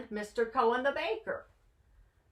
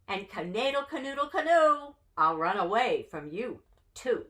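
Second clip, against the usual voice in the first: plain reading voice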